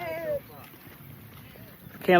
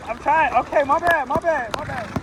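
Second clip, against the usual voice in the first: ordinary talk, nothing marked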